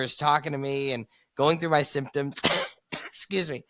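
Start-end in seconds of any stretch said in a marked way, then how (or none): none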